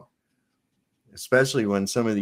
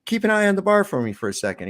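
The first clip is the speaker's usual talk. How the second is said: fast, higher rushed ask; hurried request; 9 semitones higher, 3 dB louder, faster than usual. Acted. Customer hurries out asking the barman. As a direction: rattle it off on the way out. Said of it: "He says".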